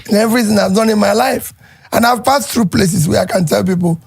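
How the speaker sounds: ordinary talk, nothing marked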